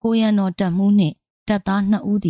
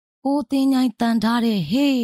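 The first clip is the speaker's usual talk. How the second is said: mild acted war cry, pitched up; 6 semitones higher than usual. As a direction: bellow the sword's name into the fight. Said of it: "ကြောက်မက်ဖွယ်ရာအသံနက်ကြီးဖြင့်ကျုံးဝါးရင်း".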